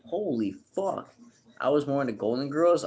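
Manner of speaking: normal talking voice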